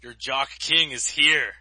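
slow, booming villain proclamation; dark lord. Pretend. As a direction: lord it over the nerds, slow and loud. Guest continues mock villain entrance.

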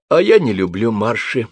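ordinary delivery

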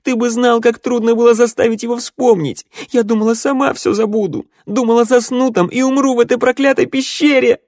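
high, loud, anguished cry; choked-back sobs. A narrator's reading. Daughter forgotten by her father, crying out in anguish. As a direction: wail it out, fighting back sobs. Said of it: "Девушка подавила всхлип".

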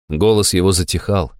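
usual speaking voice

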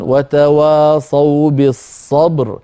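"بالصبر" slowed, with a thick stopped r at the end; heavy r at the pause. Shown as a demonstration. Sheikh gives a second example of the heavy r. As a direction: recite "وتواصوا بالصبر" slowly, closing on a heavy r. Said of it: "أيضًا".

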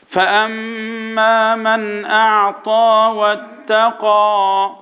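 very slow, sustained tajweed chant; Quran recitation. Shown as a demonstration. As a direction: intone the verse with long held vowels. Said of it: "قول وراي".

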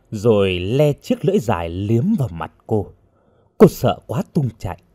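plain reading voice

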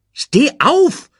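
high, loud cross command; snapping. A narrator's reading. Timon orders kneeling Pumba up, snapping. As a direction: snap at your grovelling friend. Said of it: "fuhr Timon ihn an".

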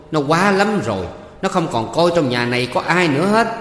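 loud, high aggrieved outcry; outraged aunt calling her husband to witness; shrieking complaint. A narrator's reading. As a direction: wail your grievance for the whole house. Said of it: "Bà Hương tru tréo lên".

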